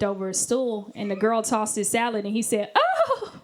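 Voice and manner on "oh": high, mock-feminine "oh"; feminine moan.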